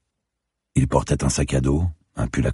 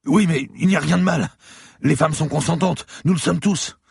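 much higher, louder panicked man; a beaten lawyer gasping in fear; frightened bleeding man pleading with the cop; terrified captive; 3 dB louder than usual. Acted. Lawyer justifies.

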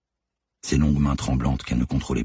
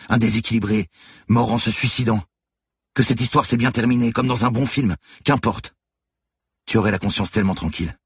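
raised, higher voice of indignation; tearful revolt; 8 semitones higher, 3 dB louder than usual. A narrator's reading. Lucie in tears lecturing Manon on her choice, revolted. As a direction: argue back hard, pitched up and insistent. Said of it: "le ton de la révolte".

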